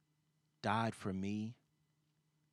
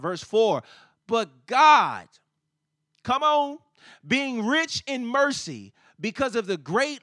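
full-force preaching; 8 semitones higher, 14 dB louder than usual. Real, not acted. Preacher declaring God's rich mercy to the congregation, exultant shouted preaching. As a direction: proclaim it at the top of your voice.

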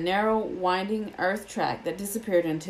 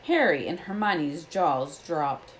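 plain reading voice

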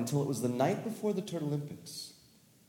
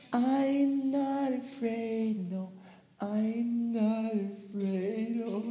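higher, slow, faltering song; rising fear; 10 semitones higher, much slower than usual. Acted. Tommy singing alone, voice climbing with fear. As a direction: let the fear creep up, slowly. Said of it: "help himself not be so afraid".

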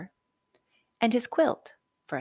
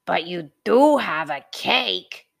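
louder, gruff bridge troll; menacing troll; 8 dB louder than usual. A narrator's reading. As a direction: block the way as a greedy troll. Troll figure.

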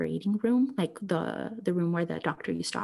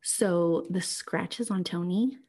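ordinary talk

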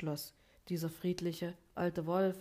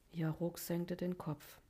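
usual speaking voice

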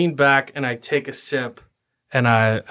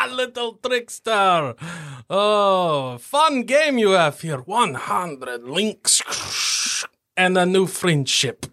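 shouted then higher slow gloating; gloating dwarf taunt; 5 semitones higher, slower than usual. Acted. Dwarf taunting the halfling after winning, shouting then slow and higher. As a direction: rub the win in his face.

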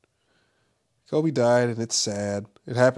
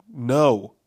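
louder, emphatic "no" of disbelief; retold denial; 2 semitones higher, 6 dB louder than usual. Man rejecting news of Kobe's death, in denial. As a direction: refuse to accept the news. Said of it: "In denial, like".

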